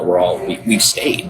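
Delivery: usual speaking voice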